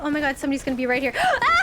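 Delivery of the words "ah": high, loud gasping yelp; sudden high scream of alarm; startled scream. Real, not acted. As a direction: shriek as if ambushed. Startled in a tense game.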